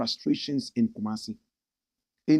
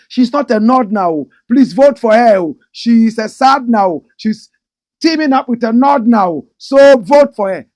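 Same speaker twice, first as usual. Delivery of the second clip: mock campaigning; 8 semitones higher, 14 dB louder than usual. Mocking campaigners begging for votes, loud and high. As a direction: plead like an overeager campaigner.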